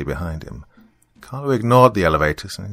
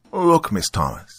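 stern, raised-pitch opening; 8 semitones higher than usual. Acted. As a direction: cut in sternly on a debtor. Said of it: "he said sternly".

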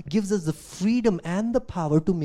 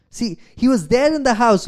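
louder, higher, driving point; emphatic insistence; 8 semitones higher, 10 dB louder than usual. Real, not acted. Preacher insists to congregation, loud. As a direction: press the point home.

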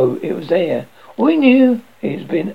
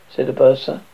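ordinary delivery, nothing marked

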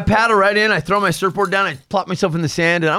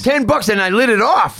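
ordinary talk

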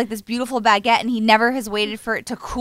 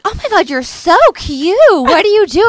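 high, gushing mock mom; a hammed-up gushing mother; 7 semitones higher, 5 dB louder than usual. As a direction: gush at her like an excited mom. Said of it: "my mom will be like".

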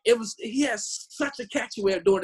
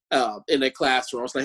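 ordinary talk

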